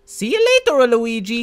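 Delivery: very high, loud cartoon Mario; cheerful Mario farewell. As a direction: sing out a goodbye.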